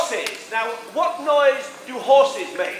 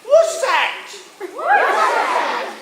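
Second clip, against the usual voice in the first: Cockney "What's that?"; 11 semitones higher, much slower than usual. Shown as a demonstration. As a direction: squeal it like a cheeky Cockney. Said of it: "Or you could say".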